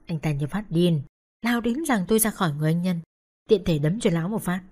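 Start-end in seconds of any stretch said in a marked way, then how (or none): none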